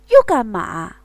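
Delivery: loud, irritated retort; annoyed man. Acted. Man to his lover, loud irritated retort. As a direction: snap back, reluctant to stop.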